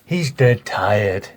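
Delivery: low, very slow delivery; film hero impression. Mate correcting host's impression of the hero, low and slow. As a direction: drop it low and draw out "dead tired". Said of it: "I thought he says it like".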